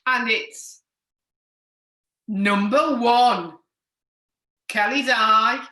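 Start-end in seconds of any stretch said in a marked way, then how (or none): 2.3-3.5 bingo caller. Acted. Bingo call, slow and loud. 4.7-5.6 bingo caller. Acted. Bingo call nickname.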